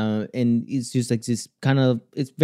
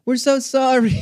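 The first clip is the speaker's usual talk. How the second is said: retold scream; 12 semitones higher than usual. Audience calls out to the upset singer, mock screaming. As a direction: squeal it like an apologetic crowd.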